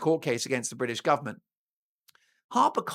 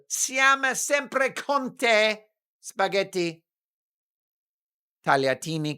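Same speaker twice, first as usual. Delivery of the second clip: mock Italian; 2 semitones higher than usual. String of Italian words as riff.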